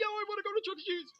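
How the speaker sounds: put-on very high, pleading child voice; child begging his mom, high and whiny; kid breaking. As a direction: cave and beg like a little kid. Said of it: "I'd break".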